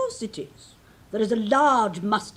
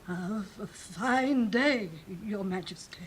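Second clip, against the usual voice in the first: timid greeting; 9 dB quieter than usual. Acted. Duchess greeting the queen timidly, much softer. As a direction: stammer it out in fear.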